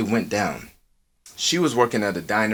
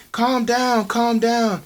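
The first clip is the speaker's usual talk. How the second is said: high soothing plea; 12 semitones higher, much slower than usual. Retold or imitated. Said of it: "She's like".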